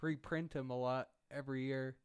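normal talking voice

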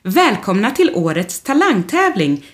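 high, loud stage welcome; cheerful host greeting. Acted. Rodolfo welcoming the crowd from the stage, loud and high. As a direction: greet the whole hall like a host. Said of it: "säger han glatt".

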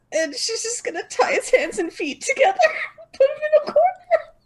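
words breaking into high laughter; laughing. Real, not acted. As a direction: giggle through the words. Laughing through description.

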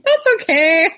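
patronizing pat on the head to her husband; teasing him in a high sweet voice; mock reassuring. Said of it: "I'm like".

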